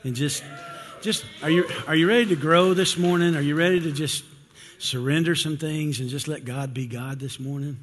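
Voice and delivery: rousing preacher call to the congregation; rally the room, loud and fast at first; preaching. Acted. Preacher firing up the congregation, loud and fast.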